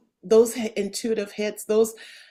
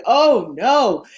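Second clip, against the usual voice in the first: alarmed; 3 semitones higher, 9 dB louder than usual. Retold or imitated. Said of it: "spirit teams were just like".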